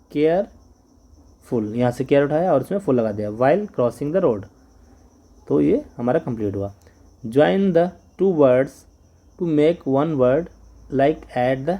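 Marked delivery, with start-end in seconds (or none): 0.1-1.7 careful stretched very slowly; drawn out. Shown as a demonstration. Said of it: "stretched take". 7.2-10.5 very slow instruction reading; teacher reading the task slowly; slow read-aloud. Shown as a demonstration.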